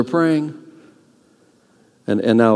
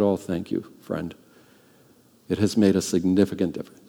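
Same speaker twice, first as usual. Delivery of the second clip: quiet thanks; 6 semitones lower, 9 dB quieter than usual. Retold or imitated. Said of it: "And I said".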